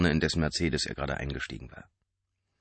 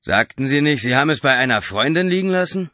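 higher, louder prying question; suspicious driver; 8 semitones higher, 9 dB louder than usual. A narrator's reading. Driver interrupting Mark's thoughts, suspicious and loud. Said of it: "unterbrach der Fahrer Marks Gedanken".

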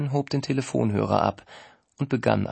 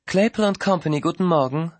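rattled off in one breath, higher and louder; all in one breath; 6 semitones higher, 4 dB louder, faster than usual. A narrator's reading. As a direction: run the greeting together without a pause. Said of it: "alles in einem Atemzug".

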